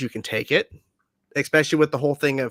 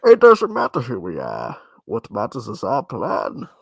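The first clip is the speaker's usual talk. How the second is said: lightly voiced menacing villain; Bane; 4 semitones lower than usual. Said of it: "That, that's Bane".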